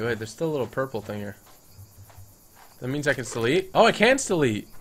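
normal talking voice

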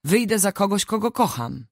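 starts high, then settles; defiant cry. A narrator's reading. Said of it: "krzyknęła". (okrzyk sprzeciwu, umiarkowanie podniesiony)

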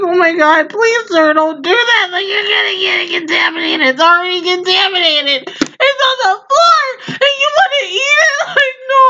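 high, climbing, laugh-strained plea; incredulous pleading. Retold or imitated. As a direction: act out begging in disbelief, building to a squeal. Acts out pleading with drunk customer.

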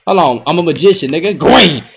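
ordinary delivery

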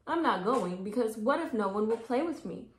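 lightly voiced worried girl; worried child. A narrator's reading. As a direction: list the fears, building up. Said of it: "said Rosie".

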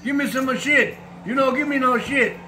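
hammed-up Italian guy complaining. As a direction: call down to the desk like the clueless Italian. Italian guy calls hotel.

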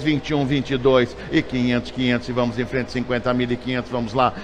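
usual speaking voice

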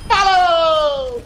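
loud, high stretched farewell; drawn-out taunt. Real, not acted. Streamer mocking a beaten enemy, drawn-out taunt. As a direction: sing out a gloating bye.

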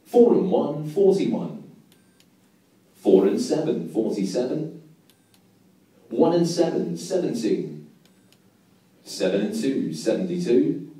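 steady sing-song number calling; bingo caller. Acted.

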